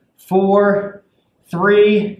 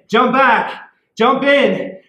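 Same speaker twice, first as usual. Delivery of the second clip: normal talking voice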